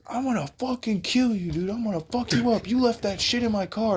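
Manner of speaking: high, strained imitation of a ranting man; mock screaming. Crackhead threatening the husband over his car, high mock scream. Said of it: "he starts screaming out of the car".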